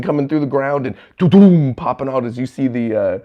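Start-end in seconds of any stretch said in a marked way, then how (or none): 1.2-1.7 loud booming "doo-doom"; mouthed impact sound; imitated crash effect. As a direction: punch it out as a comic-book rumble. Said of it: "sound effects crashing".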